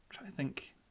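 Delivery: whispered to self; under the breath, thinking aloud; whispering. Real, not acted. Muttering to self while thinking.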